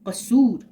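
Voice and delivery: कसूर with a long open oo, said clearly; teacher modelling कसूर with a clear open oo; waw maroof demo. Shown as a demonstration. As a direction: sound out the oo in कसूर fully. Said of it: "वाव मारूफ वो वाव है जो".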